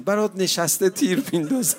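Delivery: a crippled fighter begging Hussein to let him stay; pleading cry. Acted. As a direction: beg not to be sent away. Legless fighter begs Hussein.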